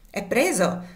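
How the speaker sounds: a loud, proud cry; boastful call of victory; triumphant exclamation. A narrator's reading. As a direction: shout the news in triumph. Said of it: "esclamò".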